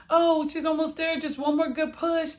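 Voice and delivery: high, brisk mock nurse voice; imitating the nurse. The nurse coaching her through the last push, in a high, quick imitation. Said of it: "So she was like".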